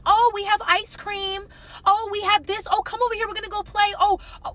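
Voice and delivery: high mocking sing-song; mock their fake cheerful calling. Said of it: "They would purposely".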